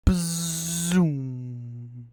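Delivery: buzzing mock plane whoosh; plane zoom noise. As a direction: make the zooming engine sound.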